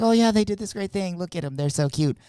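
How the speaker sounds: high, gushing mock praise; patronizing praise. Giving in-laws an obligatory shout-out, patronizing gush. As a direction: gush dutifully over a relative's little project.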